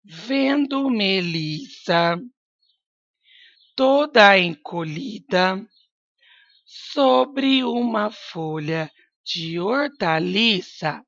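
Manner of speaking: slow, drawn-out dictation; teacher dictating slowly. Shown as a demonstration. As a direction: stretch every word for the children to write. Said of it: "teacher dictating slowly".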